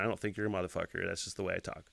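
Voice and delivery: ordinary talk, nothing marked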